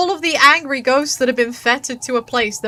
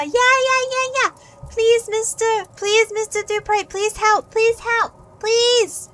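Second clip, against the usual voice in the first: pleading; 10 semitones higher, slower than usual. Pretend. Begging NPC, over-acted pleas.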